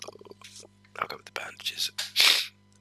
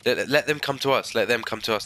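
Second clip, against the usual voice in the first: urgent call; 5 semitones higher, 7 dB louder than usual. Real, not acted.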